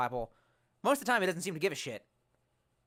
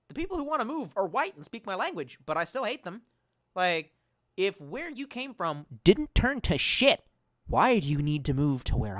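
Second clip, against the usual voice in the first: higher, louder angry rant; heated rant; 5 semitones higher, 8 dB louder than usual. Real, not acted. Streamer ranting to chat about newcomers, heated. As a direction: vent the grievance hard.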